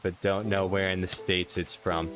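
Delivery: ordinary delivery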